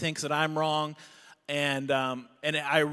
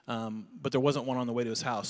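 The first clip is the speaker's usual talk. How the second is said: usual speaking voice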